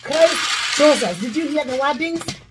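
ordinary talk

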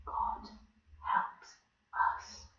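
soft breathy whisper for the ants. Shown as a demonstration. Telling the tiny ants with a quiet voice, whisper. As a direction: whisper it so the ants can hear. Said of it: "Quiet voice".

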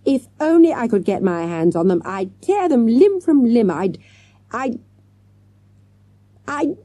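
fierce threat that loses its words; angry teacher too upset to finish; rage that falters. A narrator's reading. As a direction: splutter to a helpless stop. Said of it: "her voice faltered".

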